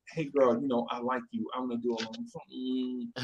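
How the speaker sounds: soft, quiet smooth pickup line; smooth talker. Retold or imitated. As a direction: croon it like a smooth player. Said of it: "we call it, like, smoothness".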